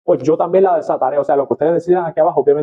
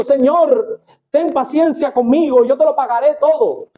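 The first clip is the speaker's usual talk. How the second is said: higher, begging servant voice; pleading servant; 10 semitones higher, slower than usual. Acted. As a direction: plead with the master for mercy. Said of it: "le suplicaba diciendo".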